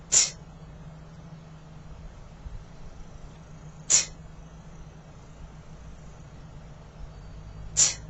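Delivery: "T" with a strong puff of air after it; aspirated t. Shown as a demonstration. Teacher drilling students on the aspirated t. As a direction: drill t, breath pushed out. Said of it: "let's practice this again".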